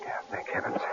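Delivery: breathy whispered thanks; relieved whisper. Acted. Relieved murmur after tension.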